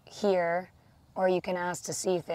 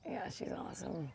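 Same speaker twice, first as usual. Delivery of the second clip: soft whispered aside; whispering; 13 dB quieter than usual. Real, not acted. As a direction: whisper it fondly. Fond aside about grandma.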